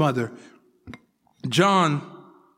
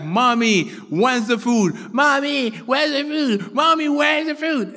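put-on child's whine climbing higher and higher; whiny kid; 6 semitones higher than usual. A child after church whining to his mom for food. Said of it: "I would whine".

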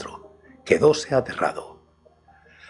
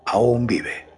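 measured, deliberate statement; slow pronouncement; 2 semitones lower than usual. A narrator's reading. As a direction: say it slowly, as a verdict. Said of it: "dijo lentamente estas palabras". (dicho lentamente, con gravedad)

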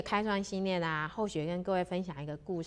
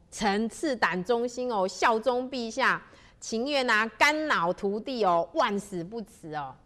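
fervent loyalty; 4 semitones higher, 6 dB louder than usual. A narrator's reading. Kneeling minister swears loyalty to the emperor, fervent. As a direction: declare devotion with force to the throne.

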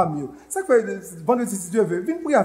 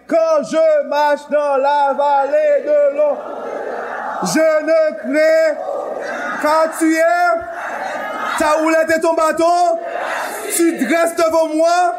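preaching a psalm; 10 semitones higher, 4 dB louder than usual. Real, not acted. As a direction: proclaim each phrase with pauses. Reciting Psalm 23 while preaching.

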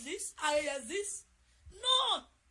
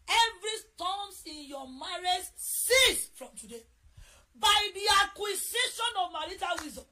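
preaching at full force; 3 semitones higher, 11 dB louder than usual. Acted. Pastor declares over every marriage, booming prayer. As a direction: command the storms to cease like a preacher.

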